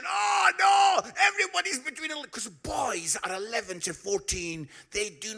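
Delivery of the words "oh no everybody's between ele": high, loud panicked yelp; panicked thinking. Retold or imitated. Preacher recalling his panic in the minibus, high loud yelp. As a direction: blurt it out in a rising panic. Panicked inner thoughts shouted.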